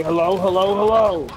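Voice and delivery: a lightly mimicked grand entrance; Shaver Mike. Podcast guest imitates his friend's signature entrance to parties, playful greeting. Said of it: "has a signature entrance".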